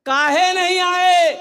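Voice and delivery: high, drawn-out loud demand; shouted reproach. Acted. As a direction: shout the question, stretching each word. Acted loud challenge to absentees.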